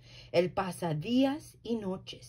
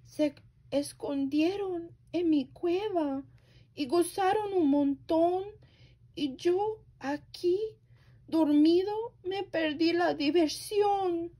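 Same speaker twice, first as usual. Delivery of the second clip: higher whimpering bear voice; whiny crying bear; 9 semitones higher than usual. Acted. Bear complaining he missed the party, whimpering high. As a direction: whine like a sad little bear.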